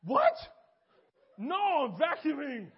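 high, loud and slow, caught out; a yelped "what", then a slow cover story; young man caught almost crying, blurting a loud denial; defensive denial. Retold or imitated. Caught near tears, denies it.

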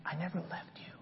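tender whisper; God whispering to the lost. Acted. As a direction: whisper it close to the ear. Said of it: "And God says".

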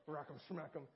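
he mutters it silently in his head while his minister watches; inner grumble. Retold or imitated. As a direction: grumble it under your breath. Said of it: "in my head, I'm thinking".

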